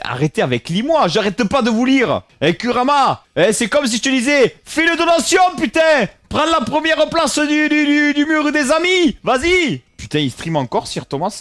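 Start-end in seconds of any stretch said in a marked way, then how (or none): 0.0-9.8 exasperated rant climbing into a high, loud yell; ranting shout. Pretend. Streamer ranting at chat about reading messages and donations, building to yelling.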